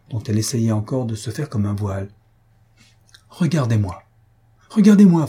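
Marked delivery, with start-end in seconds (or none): 4.7-5.3 high, loud urgent command; insistent demand. A narrator's reading. Mario holds Faustine's hands, demanding loudly that she look. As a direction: raise it sharply to compel attention. Repeats the appeal far more forcefully.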